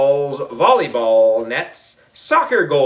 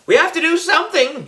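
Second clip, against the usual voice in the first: much higher, urgent cry; rally the class in alarm; urgent yell; faster than usual. A narrator's reading. Emily urges the class to act, urgent high yell. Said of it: "yelled Emily".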